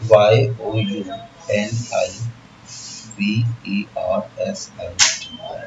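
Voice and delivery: slow letter-by-letter spelling; teacher spells the word letter by letter; spelling out slowly. Shown as a demonstration. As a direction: read each letter out slowly and quietly. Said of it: "अब जरा पढ़ो तो".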